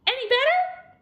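high, loud hopeful question; hopeful asking. A narrator's reading. Sheep asking Douglas about the mended hat, high hopeful voice. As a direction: pitch the question way up. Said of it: "they asked".

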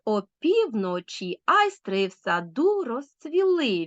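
slow triple beat stressing the middle syllable, as in "півночі"; amphibrachic scansion. Shown as a demonstration. Teacher asking pupils to feel the beat, slow waltz stress on "О півночі". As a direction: draw out the stressed vowels of "півночі", "айстри", "саду", "розцвіли". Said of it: "з наголосом на другому".

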